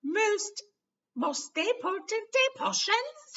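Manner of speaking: very high, loud, slow, drawn-out; suspicious librarian. Acted. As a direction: eye them suspiciously. Madam Pince voiced.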